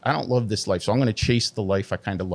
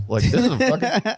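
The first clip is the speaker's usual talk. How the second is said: much higher and louder, hamming up the bravado; hyped-up self-talk; 12 semitones higher, 4 dB louder than usual. Retold or imitated. Retelling his cocky self-talk, hammed-up and hyped. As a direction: pump yourself up like it's nothing.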